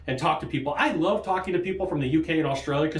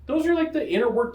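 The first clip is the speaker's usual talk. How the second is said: normal talking voice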